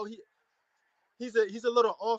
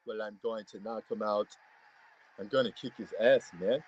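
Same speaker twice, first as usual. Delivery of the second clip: much lower, sluggish mock voice; slow-talking fighter; 11 semitones lower than usual. Fan mimicking how the boxer talks in interviews, slow-talking impression. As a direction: imitate a slow talker, pitched way down. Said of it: "He talks slow".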